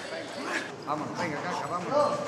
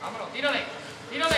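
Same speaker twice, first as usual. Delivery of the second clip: ordinary talk, nothing marked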